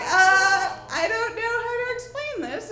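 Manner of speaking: high, loud, comically helpless; flustered stalling. Retold or imitated. Aunt stuck explaining periods.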